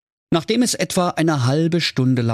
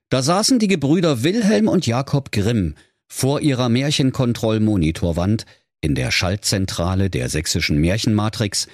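ordinary delivery, nothing marked

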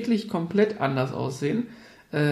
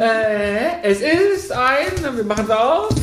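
higher, drawn out and louder; excited; 6 semitones higher, 5 dB louder, much slower than usual. Real, not acted. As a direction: build up the reveal, stretching it. Excited unboxing announcement.

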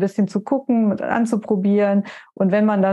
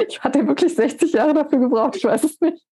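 laughing; 8 semitones higher than usual. Real, not acted. Laughs at the Mona Lisa joke.

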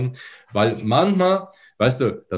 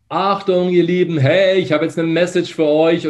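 higher, louder, open and lively announcement; open yawned throat; 10 semitones higher, 6 dB louder than usual. Shown as a demonstration. Voice coach demoing an open throat to the host, bright and louder. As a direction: keep the yawn's width and ring out. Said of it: "gähn vorher und halte diese Weite".

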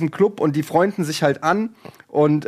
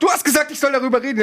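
quick, high, indignant snap; mock angry husband; 9 semitones higher, much faster than usual. Husband tearing into his wife on the drive home, quick mock anger. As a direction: tear into her like the offended husband. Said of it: "er sie einfach zur Sau macht".

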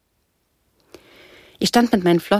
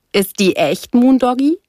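loud shout swooping up high; called question; 6 semitones higher, 5 dB louder than usual. A narrator's reading. Another surfer mocking the rescued girl, loud called question. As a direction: call the question across to him. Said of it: "rief wieder ein anderer Surfer".

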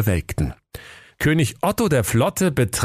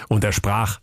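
plain reading voice

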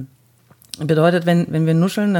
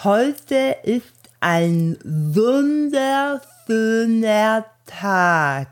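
slow, speaking with tongue stuck far out; 4 semitones higher, slower than usual. Shown as a demonstration. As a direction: stretch the tongue out and articulate slowly. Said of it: "Und jetzt alle Zunge raus".

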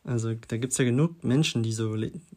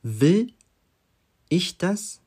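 "Will" loud and rising, set apart by a pause; stress on Will; 6 semitones higher, 4 dB louder, much slower than usual. Shown as a demonstration. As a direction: punch "Will", pause, then finish the question high. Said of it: "die Betonung liegt auf will".

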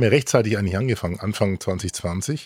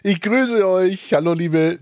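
grand welcome; 9 semitones higher, slower than usual. Acted. Host greets guests theatrically.

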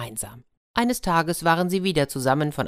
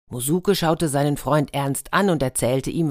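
plain reading voice

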